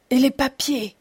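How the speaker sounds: roi qui gronde, sans grand éclat; grondement contenu. A narrator's reading. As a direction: laisse poindre la colère du roi. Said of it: "gronda le roi". (un léger grondement de colère)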